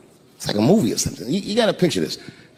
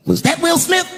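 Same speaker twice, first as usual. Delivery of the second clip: Chris Rock voice; 11 semitones higher than usual. Retold or imitated. Chris Rock grabbing the mic, teasing crowd, high voiced question. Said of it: "looks at the crowd and goes".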